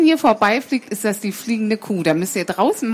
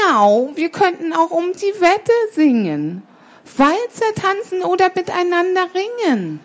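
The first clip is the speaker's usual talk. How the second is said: high, enticing cat voice; coaxing cat; 8 semitones higher than usual. Acted. Cat entices sparrow with stories and games, high coaxing. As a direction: tempt with each new offer, pitched high.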